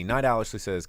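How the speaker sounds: normal talking voice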